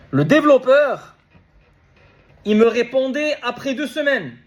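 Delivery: énervé, criant presque. Real, not acted. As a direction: lift the voice in exasperation. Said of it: "si je crie". (énervé, en haussant la voix)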